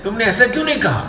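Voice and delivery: imitated high, quick reproach; angry complaint. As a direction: mimic a peeved man demanding why. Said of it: "मुझे वो भी गुस्सा हो गए".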